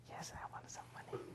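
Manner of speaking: whispered, voiceless. Retold or imitated. Grandson confiding to grandfather, whispered. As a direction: breathe it like a secret.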